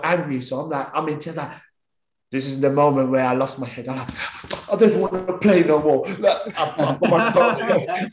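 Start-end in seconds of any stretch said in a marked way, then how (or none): 4.7-6.0 put-on louder, whiny child's wail; big baby. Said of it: "I was like a big baby". 6.2-8.1 laughing. Real, not acted. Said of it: "I was like a big baby".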